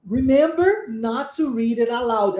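slow, careful English model sentence; measured teacher's English, words linked; model reading. Shown as a demonstration. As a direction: read it out slowly for learners. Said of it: "o que o professor está dizendo".

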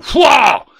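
one sudden spooky bellow to scare him; a big hammed-up ghoulish yell; terrible ghostly shout. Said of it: "the ghost raised a terrible".

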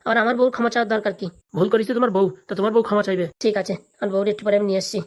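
normal talking voice

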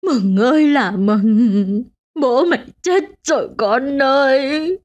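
slow, drawn-out crying wail; keen over the dead, dragging each word; loud wailing lament. A narrator's reading. Widow wails to her daughter over the body. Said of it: "bà Kha khóc to hơn".

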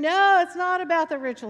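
quick, high, loud echo; cheering congregation. Retold or imitated. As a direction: imitate a church cheering back. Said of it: "they'd be cheering".

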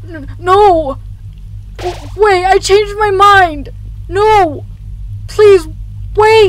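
high, loud, stammering desperate cry; panicked pleading. Acted. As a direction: beg frantically for it to wait. Desperate plea as it is lost.

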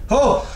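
sudden high, loud "oh"; startled yelp. Real, not acted. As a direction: jump with a short cry. Startled while hiding in a corner.

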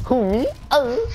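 high-pitched mock "who, me?" voice; mock innocent surprise. Retelling own reaction to teammates, mock innocent surprise.

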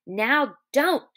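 firm, cross command; growing angry. Acted. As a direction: warn with rising anger. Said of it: "Then Lenny grew angry".

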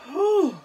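sharp high nervous blow of breath; nervous whew. Real, not acted. Vlogger bracing before a pregnancy test, nervous whew. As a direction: blow out the nerves before the result.

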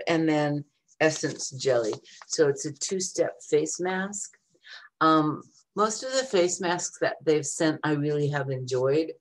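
ordinary delivery

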